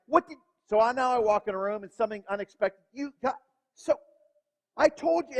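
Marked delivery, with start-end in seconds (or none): 0.1-0.4 put-on higher, louder kid's demand; kids demanding. Kids confronting dad, in a higher, louder demanding voice. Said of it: "So now kids is like". 2.9-5.4 put-on higher, louder kid's demand; kids demanding. Kids confronting dad, in a higher, louder demanding voice. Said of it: "So now kids is like".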